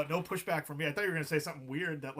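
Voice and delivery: ordinary talk, nothing marked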